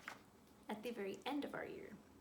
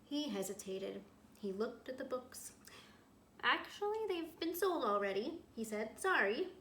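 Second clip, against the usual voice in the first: reading a story aloud to a class; storyteller voice for young listeners; picture book read-aloud; 8 dB louder, slower than usual. A narrator's reading. Teacher reads picture book aloud.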